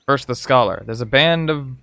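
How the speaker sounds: ordinary talk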